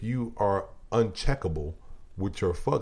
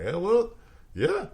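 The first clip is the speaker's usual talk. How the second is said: much higher, playful impression of his friend; mimicking his friend; 11 semitones higher, slower than usual. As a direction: pitch way up to mimic the buddy shrugging it off. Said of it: "He like".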